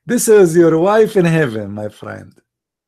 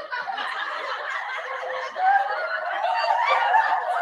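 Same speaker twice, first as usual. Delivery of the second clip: high, far laugh; 10 dB quieter than usual. Real, not acted. Laughs at own mock excitement.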